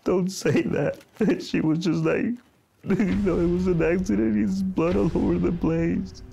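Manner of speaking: fresh grief. Real, not acted. Father recalls son's death.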